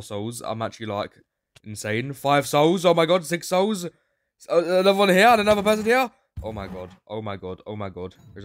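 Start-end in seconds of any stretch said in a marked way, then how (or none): 2.1-6.1 high, loud rapid excitement; squealing, fast and loud; hyped. Real, not acted. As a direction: rattle it off in a frenzy. Souls piling up fast.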